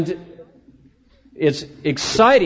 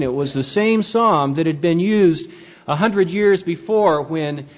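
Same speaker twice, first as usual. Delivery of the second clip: ordinary talk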